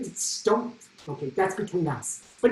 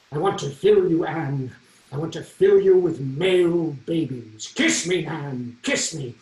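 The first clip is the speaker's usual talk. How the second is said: a touch of grand Henry the Eighth, louder and slower; kingly stage lines; 6 dB louder, slower than usual. Acted. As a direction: declaim it like a lusty king on stage. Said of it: "these incredibly provocative lines".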